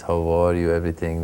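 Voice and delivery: slow, measured Iranian-accented English. Shown as a demonstration. Comedian showing the interviewer how Iranians talk, slow Iranian accent. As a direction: demonstrate a leisurely Iranian accent. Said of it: "Iranian accent is very slow".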